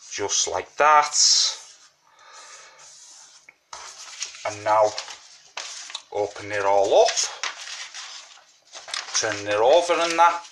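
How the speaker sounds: talking around a mint; words round a sweet in the mouth; mouth full. Real, not acted. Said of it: "Sorry, I've got a sweet in me mouth".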